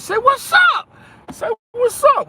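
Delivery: very high, loud shouted call. Real, not acted. Streamer shouting at silent viewers to say hello, loud. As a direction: yell for the chat to speak up.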